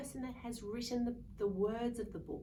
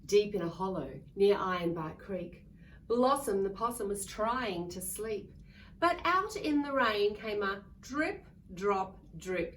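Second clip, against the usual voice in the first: put-on loud, lively read-aloud for kids; storybook reading; 8 dB louder than usual. A narrator's reading. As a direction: read it big for a room of children. Reading picture book to children.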